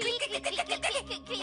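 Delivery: bad acting clicking sounds. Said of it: "lance-toi".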